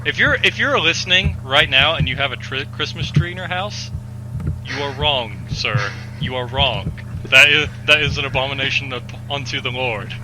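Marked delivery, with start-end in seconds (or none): none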